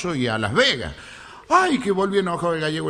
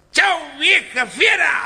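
high, slow, drawn-out mock shout; drunken attack; 10 semitones higher, 3 dB louder, much slower than usual. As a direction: yell the insult across at the old woman. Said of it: "ahí nomás la atacó". (grito burlón, agudo y arrastrado)

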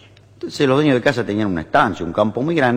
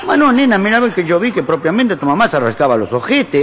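put-on high, insistent Italian-accented protest; Italian father; 5 semitones higher, 3 dB louder than usual. Italian father contradicting his daughter, insistent accented protest. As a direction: argue back with a thick Italian accent. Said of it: "Pero papá".

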